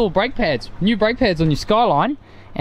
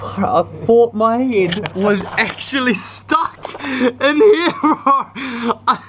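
laughing in relief; 4 semitones higher, much slower than usual. Real, not acted. Laughing after hitting his head.

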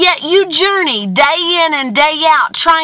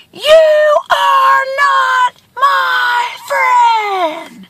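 very slow, high holler, each word held; long high call with stretched vowels; screamed rebuke; 12 semitones higher, much slower than usual. A narrator's reading. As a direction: shout it word by word across a canyon. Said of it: "He screams again".

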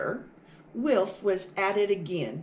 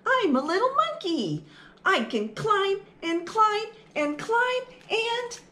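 high squeaky-sounding boasting; excited little mouse; 11 semitones higher than usual. Acted. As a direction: show off like a tiny climbing monkey. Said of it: "little mouse boasting".

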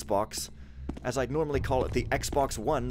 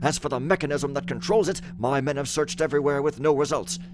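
raised, loud theatrical reading voice; dramatic letter reading; 3 semitones higher, 5 dB louder than usual. Acted. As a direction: read the letter like a stage messenger. Reads in-game letter and text dramatically.

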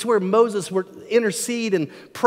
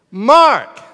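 loud one-word call, higher pitch; 7 semitones higher, 13 dB louder than usual. Acted. Parent calling child who gives nothing back, loud call. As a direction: call firmly to an unanswering child. Parent calls again, no answer.

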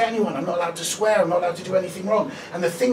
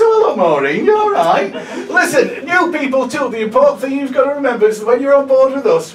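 loud, higher, over-bright compere patter; cheesy host voice; 6 semitones higher, 11 dB louder than usual. Retold or imitated. Comedian imitating the cruise director welcoming passengers, cheesy compere voice. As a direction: ham up a cruise-ship host welcoming the crowd.

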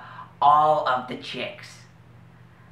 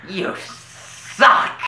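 contempt; 6 semitones higher, 5 dB louder than usual. Acted. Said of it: "Don't say it with such contempt".

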